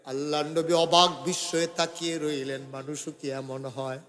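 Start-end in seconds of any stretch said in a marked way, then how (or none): 2.7-4.0 low, soft question; hushed wondering. Real, not acted. Preacher marvelling at such a person, low hushed wonder. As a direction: ask it softly, dropping the voice. Low take before chanted repeat.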